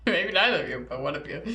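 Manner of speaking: low, very soft echo; hushed mimicry. Player echoes the game master's suspenseful line, low and hushed. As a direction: mimic the ominous narrator under your breath.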